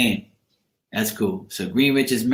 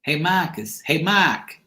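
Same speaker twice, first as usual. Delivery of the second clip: higher-pitched Boston imitation, R dropped; Boston accent; 5 semitones higher, slower than usual. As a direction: mimic a Bostonian calling out a name. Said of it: "And they say".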